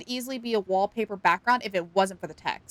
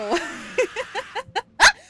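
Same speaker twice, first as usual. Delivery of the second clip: loud, high burst of laughter; startled laugh; 12 semitones higher, 6 dB louder than usual. Real, not acted. Streamer startled by chat alert, high laughter. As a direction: laugh hard and high after a jolt.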